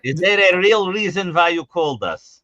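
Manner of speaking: put-on comic Yiddish-sounding accent; mock Yiddish-like accent. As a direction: ask it with a put-on Yiddish lilt. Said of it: "Is this Yiddish?".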